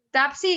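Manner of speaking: "Tapsi" with p in place of the k of taxi; P substituted for K. Retold or imitated. Teacher imitates a student's error, P before S. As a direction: say "Tapsi", lips closing before the s. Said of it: "de P antes de".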